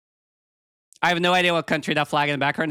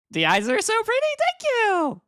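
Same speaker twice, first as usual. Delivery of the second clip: cute anime streamer. Real, not acted. Reading compliment.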